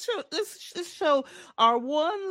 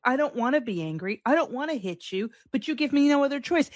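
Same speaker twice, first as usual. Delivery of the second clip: normal talking voice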